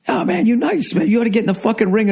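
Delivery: high, friendly gym fighter; boxer voice. Retold or imitated. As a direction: pitch way up, casual sizing-up. Mimics the boxer's sweet talk.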